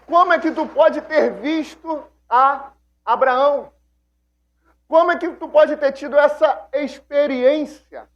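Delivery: high, loud incredulous questions; astonished disbelief. Retold or imitated. Jesus's enemies, astonished, challenging him about Abraham. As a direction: fire back at him in shock. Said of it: "Eles tomam um espanto".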